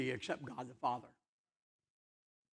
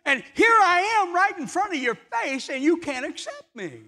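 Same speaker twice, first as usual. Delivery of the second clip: high, raised voice of Jesus rebuking the crowd; voicing Jesus; 12 semitones higher, 8 dB louder than usual. Jesus telling the crowd they search scripture, high and raised. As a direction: lift the voice for Jesus reproaching the seekers. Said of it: "and he tells them".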